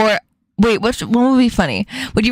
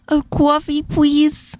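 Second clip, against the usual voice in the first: put-on cutesy New York accent, pitched up; uwu New York accent; 4 semitones higher, slower than usual. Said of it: "how the fuck they would uwu make that".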